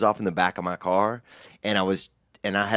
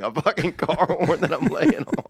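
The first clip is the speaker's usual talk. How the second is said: ordinary delivery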